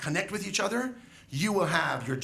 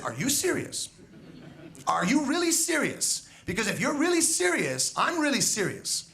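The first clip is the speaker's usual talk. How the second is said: emphatic challenge; 6 semitones higher than usual. Retold or imitated. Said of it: "I said".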